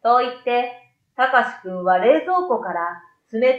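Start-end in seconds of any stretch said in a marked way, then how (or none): none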